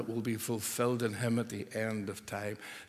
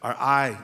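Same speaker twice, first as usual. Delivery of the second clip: I said louder; stress on I; 6 dB louder than usual. Shown as a demonstration. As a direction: press the word I. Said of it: "the emphasis".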